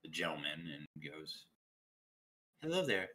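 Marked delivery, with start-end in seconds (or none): 2.6-3.1 higher-pitched, mildly nervous hello; timid character, voice pitched up; nervous greeting. Acted. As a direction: play an awkward, nervous approach. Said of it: "she nervously walks up".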